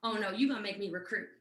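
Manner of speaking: low, quick mock dismay; retold reluctance. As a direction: drop the pitch as reluctance dawns. Retold dawning dread of recruiting.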